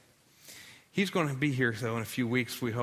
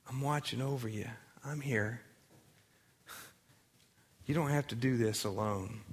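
soft reassurance; 3 semitones lower, 6 dB quieter than usual. Acted. God answers softly, reassuring.

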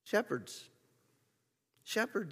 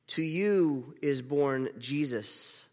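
ordinary talk

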